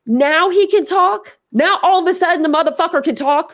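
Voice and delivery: high, indignant outburst; real flaring anger. Real, not acted. Streamer getting mad at the silent suspect, angry. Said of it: "Sorry, now I'm getting mad".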